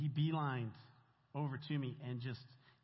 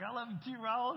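high-pitched retold goodbye, louder on the name; affectionate call back; 9 semitones higher, 4 dB louder than usual. Retold reply calling back to inmate.

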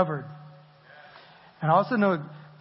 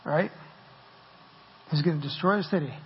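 usual speaking voice

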